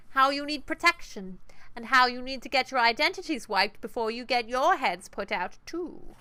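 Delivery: a menacing Triad boss, talking from the throat; throaty boss voice. Acted. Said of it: "is it, like, back in the throat?".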